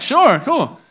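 high, singsong child imitation; breezy little kid. Three-year-old answering dad's threat, breezy and unbothered. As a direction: play a small child lightly agreeing.

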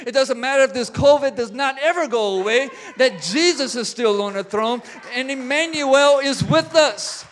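full-force revival preaching. Acted. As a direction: build the crowd up, then land it. Preaching climax, Emmanuel with us.

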